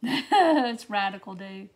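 slow, stretched surfer-dude hype; extreme hyped kids ad. Pretend. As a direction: play it like a rad toy commercial. Reacting to a "rad" comment.

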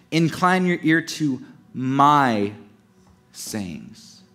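"my" drawn out, then a pause; stress on "my". Shown as a demonstration. Preacher reads the father's words, stressing "my". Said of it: "emphasize the word "my".

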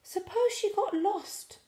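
put-on high worried baby owl; anxious little owlet, high. A narrator's reading. Sarah fearing mum got lost, high and worried.

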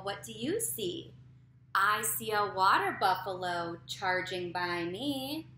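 slow, loud sing-song read-aloud; reading to a toddler. A narrator's reading. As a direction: read it slow and bouncy for little kids. Reads picture book aloud to kids.

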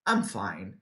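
low, quiet, unconvincing; secretly not fine. Shown as a demonstration. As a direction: claim to be okay while something is bothering you. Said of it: "no fundo alguma coisa tá pegando aí". (baixinho e desanimado, fingindo estar bem)

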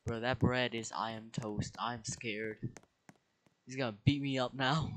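usual speaking voice

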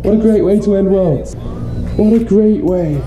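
gushing with delight over a surprise; excited. Real, not acted. Overjoyed at a cleaned room.

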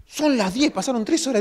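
high-pitched mock alarm on waking late; startled realization. Preacher acting out a sleeper waking to hours gone, startled. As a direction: act out a jolt of disbelief.

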